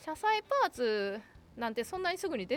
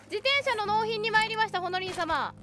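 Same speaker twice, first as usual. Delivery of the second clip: playful delivery person; 8 semitones higher, 3 dB louder than usual. Pretend. 配達員のふりで納品.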